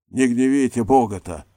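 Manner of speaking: loud, sharp rebuke; the maid scolding her mistress with heat; angry scolding. A narrator's reading. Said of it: "сердцем сказала Марфа".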